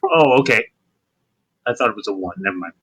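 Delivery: normal talking voice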